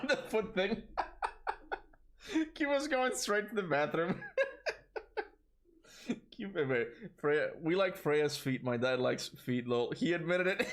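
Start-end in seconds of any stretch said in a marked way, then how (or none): 0.1-4.3 laughing. Real, not acted. Reading chat through laughter. 9.9-10.7 reads it out through a high laugh; giggling through the words. Real, not acted. Laughing at chat joke.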